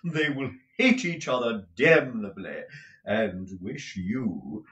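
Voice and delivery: slow, high theatrical gushing; affected, flamboyant husband. Acted. Husband picturing rejected countesses' envy, high affected gushing. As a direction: swoop and drawl with foppish relish. Mantalini's effusive speech in character.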